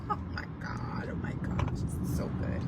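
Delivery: whispered delight to herself; excited whisper. Real, not acted. Preacher whispering in delight at her own sermon. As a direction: whisper it in awe of your own words.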